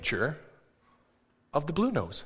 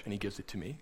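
ordinary talk, nothing marked

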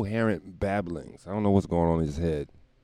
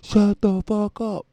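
slow, higher, louder retold yell; imitated yell; 13 semitones higher, 5 dB louder, slower than usual. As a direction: drag out the angry shout. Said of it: "And he said".

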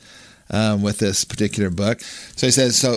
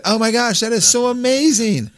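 high, excited exclamation; thrilled at the coincidence, voiced high; amazed delight; 11 semitones higher than usual. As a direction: pitch it way up in sheer amazement. Speaker's own amazed reaction retold.

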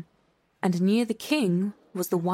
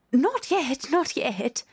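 higher, quick anxious cut-in; hasty interruption; 5 semitones higher, slower than usual. A narrator's reading. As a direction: jump in before the king. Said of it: "the rabbit hastily interrupted".

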